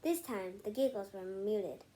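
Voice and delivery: ordinary delivery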